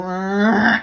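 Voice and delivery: vocal impression of a roaring motor; imitated engine noise. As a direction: make the revving sound with your voice. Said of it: "it was going for".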